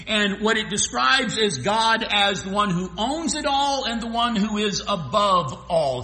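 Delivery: normal talking voice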